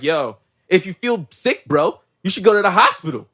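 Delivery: retold threat building to a loud finish; mock angry outburst. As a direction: imitate a guy turning up on someone. Acting out Jacob's sudden angry outburst.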